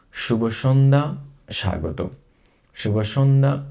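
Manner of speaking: normal talking voice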